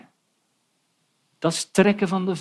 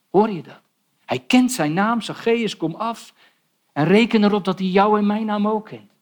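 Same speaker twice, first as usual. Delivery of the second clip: fervent preaching; 5 semitones higher, 4 dB louder than usual. Acted. Preacher climaxes on grace.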